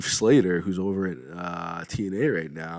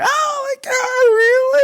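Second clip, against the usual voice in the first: much higher, louder starstruck squeal; fanboy scream; 11 dB louder than usual. Retold or imitated. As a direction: shriek it way up like a giddy fan. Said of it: "I fanboyed. I screamed".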